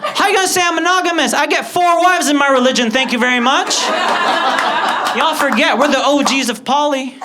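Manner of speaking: high, loud mock outrage; comically affronted tirade at full voice; offended. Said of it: "I was offended this time".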